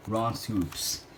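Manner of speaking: ordinary delivery, nothing marked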